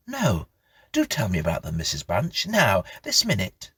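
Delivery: begging for the story now; eager young boy. Acted.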